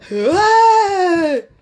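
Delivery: long, loud, high mock cry; mock wail. Pleading heart gives out, loud mock wail. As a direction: burst into a drawn-out fake wail.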